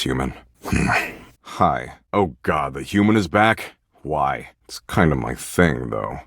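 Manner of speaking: usual speaking voice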